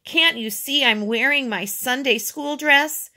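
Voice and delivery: indignant. A narrator's reading. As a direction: protest in a huff. Girl indignant about her dress.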